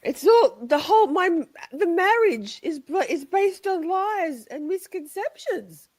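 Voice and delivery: high, melodramatic wail of betrayal; mock heartbreak. To friend about their sham marriage, mock heartbroken. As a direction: play the wounded spouse for laughs.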